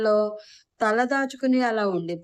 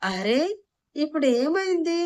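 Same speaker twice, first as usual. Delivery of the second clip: exasperated protest; 7 semitones higher than usual. A narrator's reading. Husband defends himself to crying wife.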